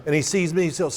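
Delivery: normal talking voice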